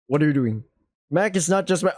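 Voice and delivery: ordinary delivery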